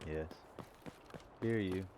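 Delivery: ordinary talk, nothing marked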